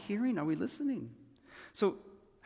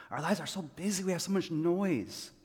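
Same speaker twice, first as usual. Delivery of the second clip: usual speaking voice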